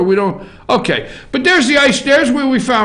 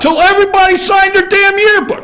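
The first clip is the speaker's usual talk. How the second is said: mocking rant; 11 semitones higher than usual. Real, not acted. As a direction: shout it like you can't believe her. Ranting about the yearbook girl.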